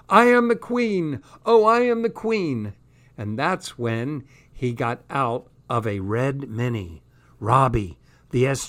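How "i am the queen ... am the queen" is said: yelling song. Acted. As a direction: belt it out like a queen. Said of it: "We were both yelling".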